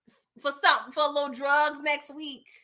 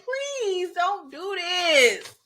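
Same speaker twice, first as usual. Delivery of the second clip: high, drawn-out mock-tearful plea; hammed-up weepy begging, starting high and sliding down; 6 semitones higher, much slower than usual. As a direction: beg slowly as if about to cry. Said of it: "start crying like [name]".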